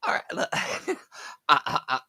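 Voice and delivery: normal talking voice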